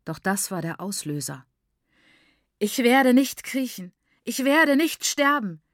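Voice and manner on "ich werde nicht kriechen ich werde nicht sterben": loud, high, furious refusal; raised, defiant outburst; raging. A narrator's reading. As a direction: rage it out at full voice. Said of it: "tobte sie".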